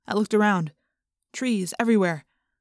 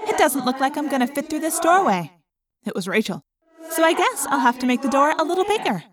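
Rachel voice; 5 semitones higher than usual. A narrator's reading. Rachel's voice in elephant morph.

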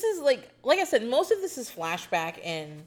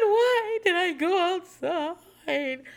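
laughing disbelief; 11 semitones higher, 4 dB louder, much slower than usual. Retold or imitated. [name] repeating agent's question.

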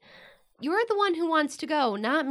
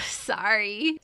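a laugh, then a sheepish sorry; laughing apology; 5 semitones lower than usual. Acted. Laughs after teasing her sister.

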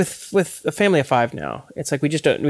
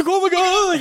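much higher, hammed-up fright; mock startled cry; slower than usual. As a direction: play the jumpy scared guy, pitched way up. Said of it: "I went like this".

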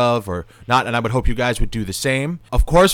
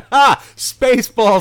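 excited; 11 semitones higher, 7 dB louder, slower than usual. Real, not acted. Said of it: "Spaceballs reference".

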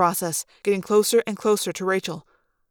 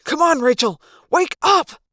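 tense urging, rising higher on the last words; urgent hiss; 7 semitones higher, 4 dB louder than usual. A narrator's reading. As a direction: plead with her to come round. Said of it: "I hissed".

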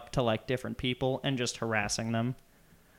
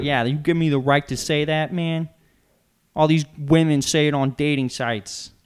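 loud, higher mock yell at the crowd; imitated angry yell; 4 semitones higher, 9 dB louder than usual. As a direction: ham up his angry comeback in a New York accent. Retold angry rant in bagel store.

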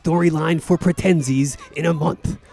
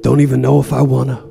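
normal talking voice

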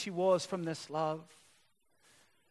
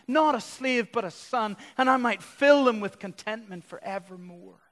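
loud, higher-pitched, impassioned preaching; full-force preaching; 6 semitones higher, 10 dB louder than usual. Acted. Preacher voicing Jesus explaining the Father's love to a crowd, full-force preaching.

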